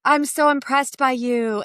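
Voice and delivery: slow, gushing admirer, not mocking; gushing fan voice. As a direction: gush with sweet awe. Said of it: "I'm not doing the voice because I'm making fun".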